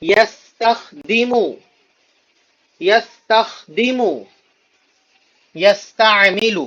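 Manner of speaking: slow, drawn-out syllables; teacher drilling pronunciation slowly; slow model pronunciation. Shown as a demonstration. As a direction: stretch each syllable of یستخدم for the class. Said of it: "تلفظ صحیح ہو جائے".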